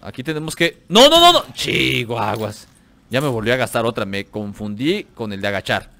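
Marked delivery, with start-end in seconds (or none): 0.9-1.5 much higher, louder frantic no's; panicked scream. Real, not acted. Gamer triggering a bomb by mistake, frantic scream. 1.5-2.7 drawn-out dismay. Real, not acted. Dismay after blunder.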